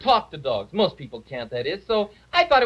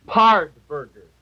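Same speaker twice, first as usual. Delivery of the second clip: dog-speak word; 3 semitones lower, 6 dB louder, slower than usual. Shown as a demonstration. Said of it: "it's right here in my dictionary".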